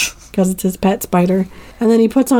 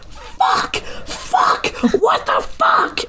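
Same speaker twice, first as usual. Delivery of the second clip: strained whispered mock scream, no voice; losing it; 4 dB quieter, much slower than usual. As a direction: imitate a frantic yell under the breath. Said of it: "losing it a little bit".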